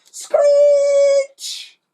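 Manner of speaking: very high loud screech; cartoon brake screech. A narrator's reading. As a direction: imitate the brakes slamming. Sound effect brakes.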